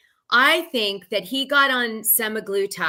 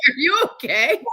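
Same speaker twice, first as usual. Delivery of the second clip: higher, lightly laughing worried question; mock worry; 6 semitones higher than usual. Retelling Dolores checking on Paulie, amused mock worry. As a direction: ask it with amused concern. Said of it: "And she's like".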